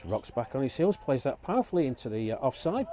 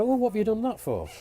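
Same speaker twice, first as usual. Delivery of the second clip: higher, mock exasperated teammate; exasperated batter; 8 semitones higher than usual. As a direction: lightly play a fed-up teammate. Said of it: "imagined batter in the dugout".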